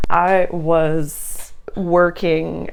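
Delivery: usual speaking voice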